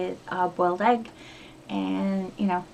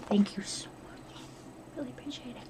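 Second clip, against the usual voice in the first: soft unvoiced whisper of gratitude; whispered thanks; 4 semitones higher, 11 dB quieter than usual. Real, not acted. Closing her thanks to Kim in a whisper. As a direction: whisper it, fading away.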